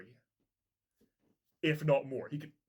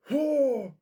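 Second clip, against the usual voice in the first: high, loud cry of amazement; excited cry; 11 semitones higher, 9 dB louder than usual. Real, not acted. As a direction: let out a thrilled "oh" at full voice. Fan reacting to thrilling reveal.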